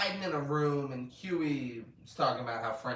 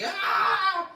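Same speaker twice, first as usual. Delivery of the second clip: high yelp of a man torn apart; short shrill cry, played for laughs; mock scream; 8 dB louder than usual. Guy ripped apart, mock scream.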